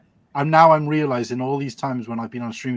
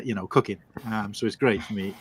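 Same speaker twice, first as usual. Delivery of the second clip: normal talking voice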